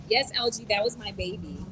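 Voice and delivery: normal talking voice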